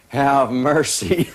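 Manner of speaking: hammed-up mock lament aloud in a theater; joking exclamation. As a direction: stretch it out as a wry joke in the movie. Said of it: "And Buddy said".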